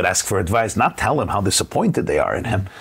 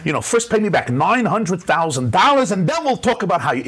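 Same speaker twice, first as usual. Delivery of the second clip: put-on high, indignant parent scolding a child; 9 semitones higher than usual. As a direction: play the offended parent, outraged. Angry parent voiced in story.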